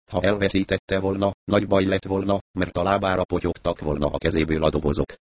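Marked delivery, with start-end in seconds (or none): none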